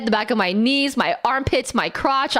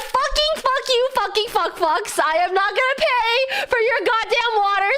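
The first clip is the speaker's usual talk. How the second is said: mock rage; 10 semitones higher than usual. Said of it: "I'm like".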